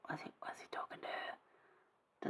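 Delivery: hushed, slow whisper to each other; a slow, secret whisper. Retold or imitated. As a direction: whisper it like gossiping disciples. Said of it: "sort of went".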